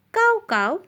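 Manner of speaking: high-pitched spoken crow call; teacher's lightly imitated cawing. Shown as a demonstration. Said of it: "Crow makes the sound cow cow".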